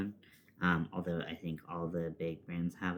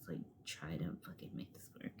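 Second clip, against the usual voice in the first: fast, softer frustrated whisper; 3 semitones lower, 11 dB quieter, faster than usual. Real, not acted. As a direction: hiss it under your breath in frustration. Frustrated muttered aside.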